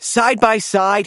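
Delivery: ordinary delivery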